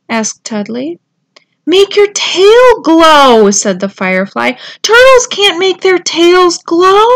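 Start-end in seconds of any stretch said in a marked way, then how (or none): 1.7-7.1 high, loud little bug voice; squeaky firefly insisting; tiny firefly voice. A narrator's reading.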